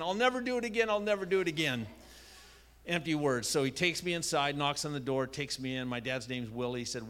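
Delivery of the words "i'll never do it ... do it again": put-on high, pleading child promise; childish pleading. Boy begs the neighbor not to tell his dad, high plea. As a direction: beg like a scared little boy. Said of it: "I'm saying".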